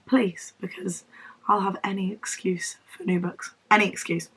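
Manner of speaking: whispered conspiratorial aside. Real, not acted. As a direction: whisper it like a secret. Whispered plea for excuse.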